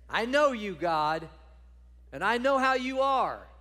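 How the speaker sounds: loud, high whine at God; a sulky prophet griping at God; exasperated complaint. Acted. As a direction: throw the complaint right back at him. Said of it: "Jonah is like".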